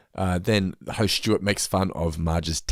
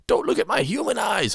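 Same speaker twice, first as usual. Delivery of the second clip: mascot voice; 10 semitones higher than usual. Retold or imitated. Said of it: "Billy Bullion, the Cube, mascot".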